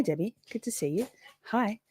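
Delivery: usual speaking voice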